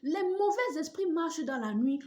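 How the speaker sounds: high loud accusing cry; imitated shouting. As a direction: scream it at the daughter-in-law. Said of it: "Et voici ce qu'elle a dit". (cri accusateur imité)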